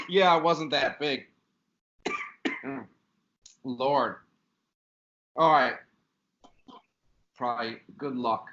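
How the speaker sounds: coughing it out between words; throat irritated from a bug; just swallowed a bug. Real, not acted. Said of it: "I swallowed a bug".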